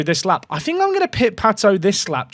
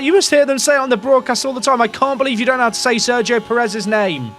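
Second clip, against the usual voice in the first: higher-pitched mock complaint; indignant commenter; 6 semitones higher than usual. Streamer retelling a viewer's complaint about his pronunciation, mock-indignant. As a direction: imitate an exasperated fan, pitched up. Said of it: "The guy was like".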